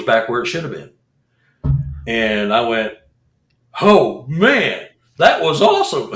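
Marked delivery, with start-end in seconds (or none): 3.8-6.2 laughing, louder "Oh, man"; laughing delight. Real, not acted. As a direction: laugh through the thrill of it. Laughing at the experience.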